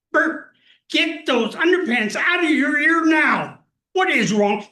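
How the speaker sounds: loud, high mock scold from a mother. Son retells his confused mother shouting at dad, a mock scolding yell. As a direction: shout it like a fed-up mom. Mother voiced in retold story.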